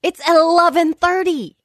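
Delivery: high, incredulous mom scolding; exasperated mom scolding. Retold or imitated. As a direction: scold the kids for being awake. Mom scolds kids at bedtime.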